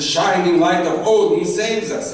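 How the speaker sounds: loud, high heroic declaration; hammed-up grand victory speech; triumphant proclamation. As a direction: proclaim it like a saga hero. Acted triumph after troll is killed.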